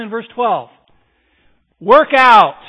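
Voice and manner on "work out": shouted command, much louder; a preacher's loud call to action; forceful shout. Real, not acted. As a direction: bark it out to rouse the crowd. Preacher exhorting the congregation.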